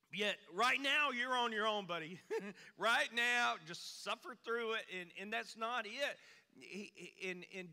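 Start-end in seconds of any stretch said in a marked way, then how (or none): 0.1-4.8 sarcastic jab. Retold or imitated. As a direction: rub it in sarcastically. Sarcastic punchline of cliche.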